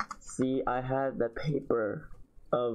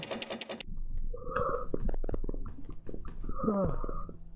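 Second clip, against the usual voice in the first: long yawning sigh; 8 semitones higher than usual. Real, not acted. Long sound before explaining yawning.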